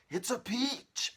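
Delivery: high, loud, slow cry; shouted. Acted. Aunt shouting about the peach, high and loud. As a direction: shout it up at the branch. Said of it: "Aunt Spiker shouted".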